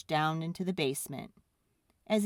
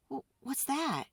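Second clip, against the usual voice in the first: put-on higher, softer curious kid; lightly voiced boy; 8 semitones higher, 3 dB quieter than usual. Boy asking about the sparkling bowl, softly. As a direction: stammer it out in wonder. Boy's voice.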